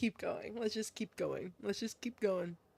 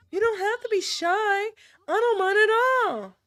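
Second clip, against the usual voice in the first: put-on higher-pitched girlish voice; coaxing girl character; 7 semitones higher, 4 dB louder than usual. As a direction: coax a shy friend in a higher voice. Same character coaxes.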